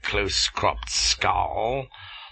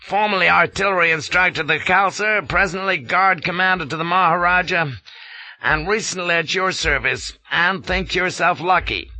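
fast, curt colonel snapping; 10 semitones higher, 4 dB louder than usual. A narrator's reading. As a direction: rattle it off brusquely. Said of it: "snaps he".

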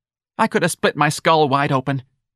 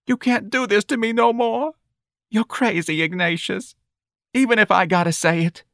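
high-pitched tearful wail of a mother; sobbing; 10 semitones higher than usual. A narrator's reading. Mother telling her son he's crazy, sobbing. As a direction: lament it high, at the edge of sobs. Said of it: "Missus Riley sobbed".

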